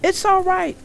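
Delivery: high, loud call back; child's carrying shout to a parent. A narrator's reading. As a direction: holler a reply to someone far off. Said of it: "Fox shouted back".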